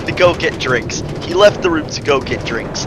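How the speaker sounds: ordinary delivery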